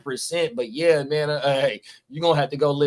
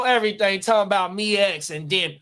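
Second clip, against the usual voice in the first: normal talking voice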